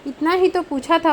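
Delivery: brash, defiant retort; insolent defiance. Acted. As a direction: talk back rudely to mother. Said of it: "उद्दंडता और हेकड़ी से बोला".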